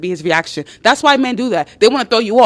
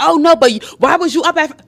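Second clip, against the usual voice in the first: raised, louder defensive protest; accusing deflection; 6 semitones higher, 4 dB louder, faster than usual. Acted. Man dodges the Sabrina question, accusing. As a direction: push back with a higher voice.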